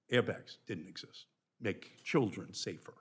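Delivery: usual speaking voice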